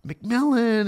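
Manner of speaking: excited recognition, voice climbing; excited fans. Retold or imitated. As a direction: imitate awestruck fans spotting the player. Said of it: "people are just so excited".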